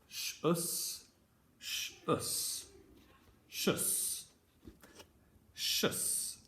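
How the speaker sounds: shus said slowly, sh then us; soft drawn-out shus; sound demo. Shown as a demonstration. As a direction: model the sound shus for children. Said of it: "Have a go at saying it after me".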